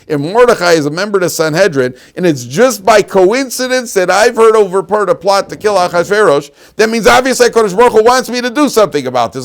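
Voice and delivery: rising, loud and fast excitement; fired-up preaching. Real, not acted. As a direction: build it up with passion. Preacher building to excited peak.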